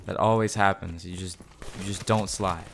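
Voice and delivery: usual speaking voice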